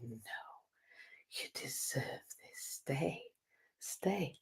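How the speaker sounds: slow seductive whisper; tempting whisper. Retold or imitated. Ego coaxing her to stay in bed, whispered temptation. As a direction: coax softly, dragging it out.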